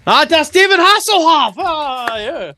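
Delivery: high, loud hammed-up German accent; mock German accent. Cohost announcing [name], hammed-up German accent. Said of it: "I'm not really good at accents".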